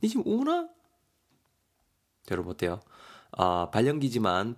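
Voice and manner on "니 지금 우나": very high teasing squeak. Acted. Mijeong teasing her friend for crying, very high. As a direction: tease him, way up high. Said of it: "미정이 말합니다".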